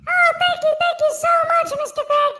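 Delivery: put-on baby voice. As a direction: thank him in a high baby voice. Puppet Baby thanks Greg.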